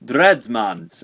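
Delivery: hammed-up attempt at a Jamaican accent; badly faked Jamaican accent. Reviewer renames the toy Dreadsman, bad Jamaican accent. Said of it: "That was the worst Jamaican accent".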